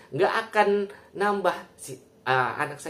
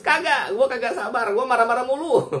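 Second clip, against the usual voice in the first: high, loud, hammed-up snapping; joking rant pitched high and loud; mock angry outburst; 11 semitones higher, 5 dB louder than usual. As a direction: play-act losing your temper, pitched way up and loud. Mock answer to praise of patience.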